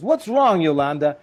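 high, loud exasperated question; impatient husband. Retold or imitated. Tony asking his wife what's wrong, loud and exasperated. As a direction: demand what she wants.

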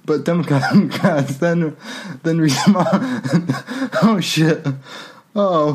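reading while cracking up; laughing. Real, not acted. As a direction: keep laughing through it.

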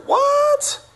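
high-pitched squeal of mock disbelief; incredulous little girl. Girl doubting her teacher's whale fact, high incredulous squeak. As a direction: squeak it out in acted shock.

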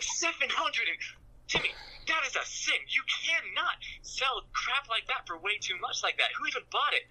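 high, squeaky outraged father voice; a hammed-up dad losing it at his son. Dad scolding Timmy over selling the bat and glove, high mock outrage.

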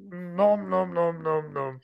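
high munching monster voice; Cookie Monster. Pretend. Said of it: "That's a good impression".

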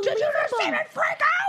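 very high incredulous squawk; mock exasperation. Mother to defiant daughter after freaking out, incredulous squawk. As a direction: squeal it in disbelief. Acted disbelief at defiant child.

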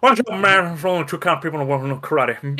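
mumbled, rushed [name] impression. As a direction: slur it like a comic [name].

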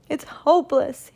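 high-pitched hopeless wail; despairing cry. A narrator's reading. Baker after a failed night's work, despairing cry. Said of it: "he cried".

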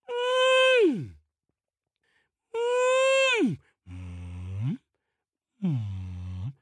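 mysterious wailing sound. A narrator's reading. A sound never heard before in the valley, eerie wail. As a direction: wail from far across the valley. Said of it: "a sound which they have never heard".

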